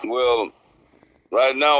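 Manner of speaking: usual speaking voice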